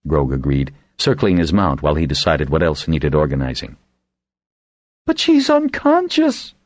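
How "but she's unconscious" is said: much higher, slow pleading woman's cry. A narrator's reading. Woman with a rash pleading for the wounded, high slow cry. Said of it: "cried the woman with the rash".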